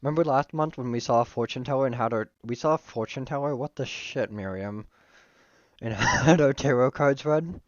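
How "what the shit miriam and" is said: ordinary talk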